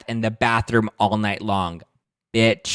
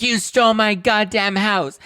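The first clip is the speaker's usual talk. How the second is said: much higher, slow, slurred yell; drunken accusation; 12 semitones higher, 4 dB louder, slower than usual. Retold or imitated. Kim screams at sister Kyle in the limo, slurred. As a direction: wail each word, sloppy and furious. Kim's famous limo line.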